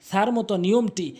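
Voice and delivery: ordinary talk, nothing marked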